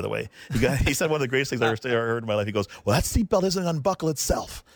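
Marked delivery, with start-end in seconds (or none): 2.8-4.6 high-pitched imitation of a coach's taunt; pitched-up heated dare; mock yelling. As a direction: goad them in a retold argument. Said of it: "And he's yelling at me".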